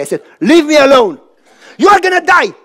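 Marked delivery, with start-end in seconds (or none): 0.4-1.2 louder, sharp "Leave me alone"; angry retort. Retold or imitated. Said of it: "Eventually, I got angry". 1.8-2.5 higher, louder shout of warning; alarmed warning. Retold or imitated. Pastor warning from boat.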